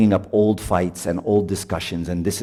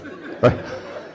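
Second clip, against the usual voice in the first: breathy laugh. Real, not acted. Laughing at the couple.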